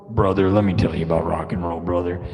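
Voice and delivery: low, fast growled wrestler line. Pretend. Streamer does his [name] take, low and fast. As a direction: growl it low like a bar singer. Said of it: "my interpretation of a".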